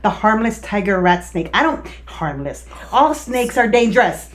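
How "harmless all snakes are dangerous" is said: ordinary talk, nothing marked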